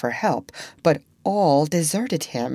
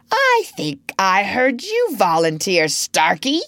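silky, purring threat with raised pitch; 4 semitones higher, 4 dB louder than usual. A narrator's reading. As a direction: purr it as a pointed suggestion. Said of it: "purring again".

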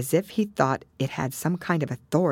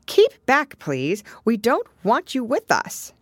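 high, loud opening burst, firm command; shooing away; 8 semitones higher, 5 dB louder, slower than usual. Acted. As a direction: order off an intruder, high and emphatic. Alice acts ordering the porpoise away.

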